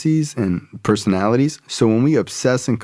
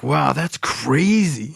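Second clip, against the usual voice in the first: mock amazed kid; 6 semitones higher, much slower than usual. Kid reacting to crack admission.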